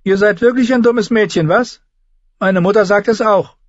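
high, sneering king voice; mocking boy-king. A narrator's reading. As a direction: belittle her like a cruel child. Joffrey mocks Sansa. (hoher, spöttischer Knabenkönig)

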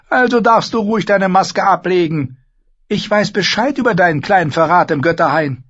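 high, loud, imperious queen voice; Cersei, haughty queen. Acted. Queen lecturing Sansa at the feast, haughty and loud. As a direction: play the proud queen lecturing the girl.

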